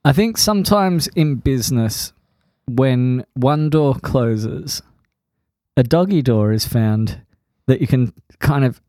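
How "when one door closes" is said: ordinary talk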